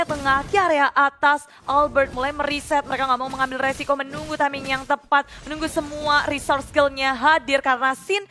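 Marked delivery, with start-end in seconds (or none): none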